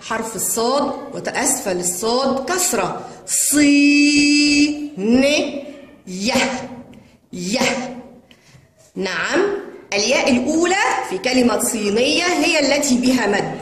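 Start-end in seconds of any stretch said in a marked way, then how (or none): 3.3-8.0 long held ee on صي, then short separate ن and يه; sounding out syllables. Shown as a demonstration. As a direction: spell it out syllable by syllable, stretching only صي. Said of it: "إنها الكسرة القوية". (تهجئة مقطعية مع مدّ صي)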